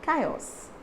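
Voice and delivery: Chaos said with a hard K, kai-os; K sound. Shown as a demonstration. Teacher models Chaos with a K sound for learners, careful pronunciation. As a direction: start Chaos with a K. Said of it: "exactly with how you pronounce K".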